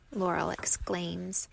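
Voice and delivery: plain reading voice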